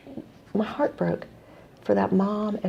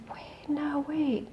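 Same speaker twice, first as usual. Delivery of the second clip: near-whispered, higher interjection; hushed second thought; 8 semitones higher, 9 dB quieter, much slower than usual. Retold or imitated. Stopping the caller as a bigger idea lands, hushed. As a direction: stop them softly, almost under your breath.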